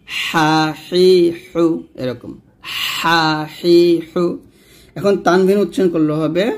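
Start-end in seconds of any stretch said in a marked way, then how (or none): 0.1-1.6 হা হি হু squeezed in the throat, slowly; slow pharyngeal হা হি হু; pressed ha. Shown as a demonstration. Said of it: "এই হাটা আমরা কিন্তু চাপ দিব". 3.0-4.2 হা হি হু squeezed in the throat, slowly; slow pharyngeal হা হি হু; pressed ha. Shown as a demonstration. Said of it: "এই হাটা আমরা কিন্তু চাপ দিব".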